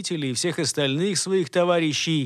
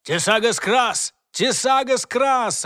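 high, loud repeated incantation; shouted spell; 7 semitones higher, 3 dB louder, slower than usual. Acted. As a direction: call the spell twice from afar. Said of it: "раздалось с другого конца тоннеля".

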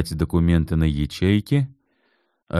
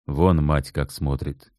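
usual speaking voice

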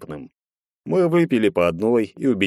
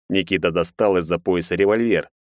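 plain reading voice

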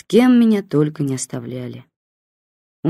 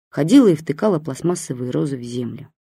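ordinary delivery, nothing marked

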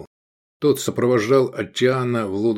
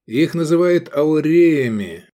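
higher, louder didactic lecturing; mentor tone; 8 semitones higher, 5 dB louder than usual. A narrator's reading. Искандер explains Roman gold coins to Эдик, in a mentor's tone. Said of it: "он заговорил менторским тоном".

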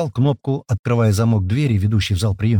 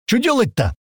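high-pitched eager question; impatient; 13 semitones higher than usual. A narrator's reading. Tomas stamping, asking for orders, impatient. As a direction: itch to get started, pitch way up. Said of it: "аж притопывая от нетерпения".

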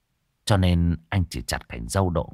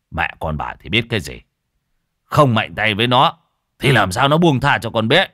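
louder, firm dismissal; stern rebuke; 3 semitones higher, 7 dB louder than usual. Acted. Father dismissing his wife's pleas, louder and stern. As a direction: brush off the wife forcefully.

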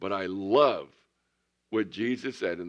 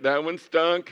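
louder, playful repeat of the verdict; loud mock verdict; 6 semitones higher, 6 dB louder, slower than usual. Preacher admits to the congregation his joke stunk, loud playful. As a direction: own it loudly and laughing at himself. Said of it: "preacher repeats the verdict loudly".

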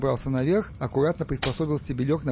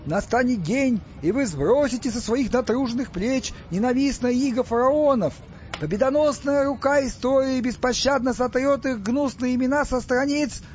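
high, ringing agitator's speech; weighty oratory; 11 semitones higher than usual. A narrator's reading. Stas rallies Egyptians against the pharaohs, declaiming weightily. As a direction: proclaim it like a revolutionary orator. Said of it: "а потому весомее".

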